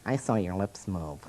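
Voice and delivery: lightly voiced kid, low and soft; a hint of a tattling child; quiet mock-kid accusation; mock eight-year-old. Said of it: "one eight-year-old who will go".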